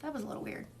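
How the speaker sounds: soft, knowing murmur; quietly noticing something off; mock suspicious aside. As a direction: say it under your breath, suspicious. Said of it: "and you're like".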